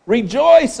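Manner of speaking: a big, loud, joyful call; loud rejoicing. Retold or imitated. Soothsayer hails the worried king, loud and joyful. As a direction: proclaim it to lift the king up. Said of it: "He, he built him up".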